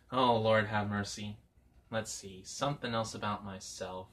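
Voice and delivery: normal talking voice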